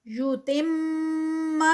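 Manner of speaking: یتم ending in a held nasal hum on the میم; tajweed recitation. Shown as a demonstration. Teacher models یتم for students. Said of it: "یہاں پر زبر ہے".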